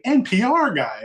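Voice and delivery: high, loud mock incredulity; retold scoffing repeat; teasing echo. Woman mockingly echoes his excuse.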